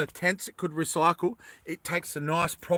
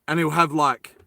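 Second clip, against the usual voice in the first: ordinary delivery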